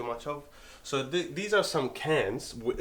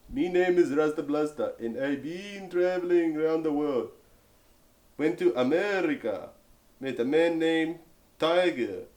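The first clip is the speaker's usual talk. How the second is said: put-on Jamaican accent, slow and a touch higher; hammed-up Jamaican patois reading; 3 semitones higher than usual. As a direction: read the can like a Jamaican Rasta, lightly voiced. Reading a Rasta can label aloud.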